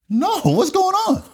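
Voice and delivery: high, drawn-out baffled question; slow, high-pitched mock alarm; bewildered denial. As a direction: act out total cluelessness, voice shooting up. Retells his answer to accusers.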